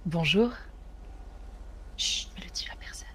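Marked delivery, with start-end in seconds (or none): 2.0-3.1 urgent secretive whisper. Pretend. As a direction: hush him in a whisper. Begging to keep the secret.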